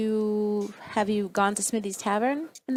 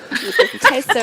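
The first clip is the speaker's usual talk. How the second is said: put-on loud, high British accent; 5 semitones higher, 9 dB louder than usual. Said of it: "In the British accent".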